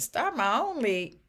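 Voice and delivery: high, loud disbelief; incredulous. Retold or imitated. Retired music teacher answering God's call, incredulous. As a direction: protest loudly, pitch leaping up in amazement.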